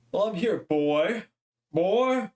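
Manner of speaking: lightly hammed-up pompous lawyer; slow pompous lawyer. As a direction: stretch it out like a grand old lawyer. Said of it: "now I gotta give him a voice".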